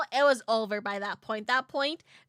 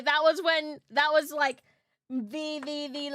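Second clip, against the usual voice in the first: normal talking voice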